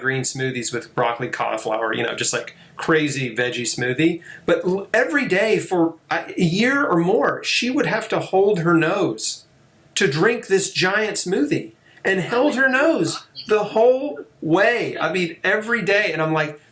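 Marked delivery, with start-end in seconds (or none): 4.4-16.1 rising, lively storytelling; excited. Real, not acted. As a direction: tell it with rising wonder. Excited retelling, nose held.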